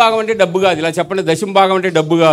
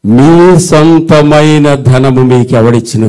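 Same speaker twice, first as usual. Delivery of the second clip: very slow, louder, low proclamation; thundering preaching; 6 semitones lower, 7 dB louder, much slower than usual. Real, not acted. Preacher proclaiming the verse to the crowd, slow and booming. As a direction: boom the verse out word by word.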